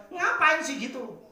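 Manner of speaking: high, drawn-out irritated scolding; retold flare-up. Preacher replays telling Riel off, irritated flare-up. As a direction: let the reproach burst out high and slow. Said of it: "lah saya langsung ngegas".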